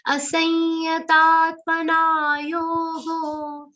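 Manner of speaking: Asanyatatmana with a long ta and a light stress. Shown as a demonstration. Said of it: "ta has to be a long sound".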